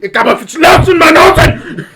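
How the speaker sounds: loud laughing German shout. Retold or imitated. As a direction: bark it like a German officer. Mock German officer shout.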